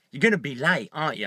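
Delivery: low, needling undertone; low insinuating prod; negative inner voice. Retold or imitated. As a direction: nag quietly from inside the head. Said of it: "the negative voice says to me".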